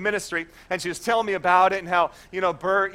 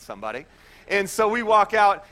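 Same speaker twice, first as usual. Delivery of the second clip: ordinary talk, nothing marked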